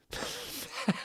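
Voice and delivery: short unvoiced laugh; a quick laugh through the nose; a stifled chuckle at the text; breathy laugh. Real, not acted. Reader laughs at own line.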